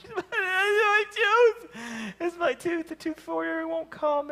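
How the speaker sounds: put-on high, slow wailing kid voice; a little girl sobbing over her lost tooth; crying child. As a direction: daughter wailing at bedtime. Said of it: "We're like".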